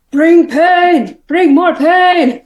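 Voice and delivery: high, loud battle cry; Bone Crusher shouting. Acted. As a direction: shout it across the arena, stretching the last word. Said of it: "Bone Crusher shouts".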